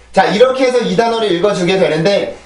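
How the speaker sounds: ordinary talk, nothing marked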